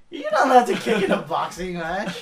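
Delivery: loud, half-laughing mock outrage; retold mad protest. Player protesting the kicking opponent, mock-angry complaint. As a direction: protest loudly, playing up the indignation. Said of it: "I got mad because he was kicking me".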